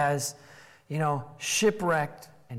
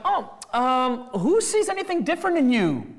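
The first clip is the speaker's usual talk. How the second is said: sarcastic; 5 semitones higher than usual. Retold or imitated. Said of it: "it's rhetorical and it's sarcastic".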